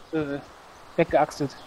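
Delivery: normal talking voice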